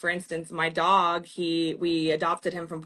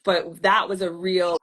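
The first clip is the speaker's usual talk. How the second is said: ordinary delivery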